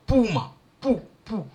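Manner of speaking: "puma, pu, pu" with an open u, clearly set apart; "pu" with a plain open u, repeated; African pu. Shown as a demonstration. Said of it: "We say".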